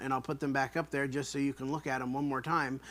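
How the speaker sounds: usual speaking voice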